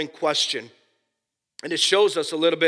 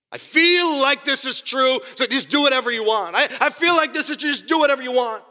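mocking flippant believer; 9 semitones higher, 4 dB louder than usual.

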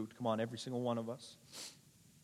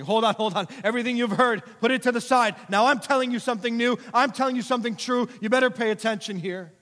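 high, loud preacher's proclamation; commanding authority; 11 semitones higher, 12 dB louder than usual. Acted. Preacher voicing Christ to the crowd, commanding authority. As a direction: demand attention with full authority. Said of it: "Christ is speaking with unparalleled authority".

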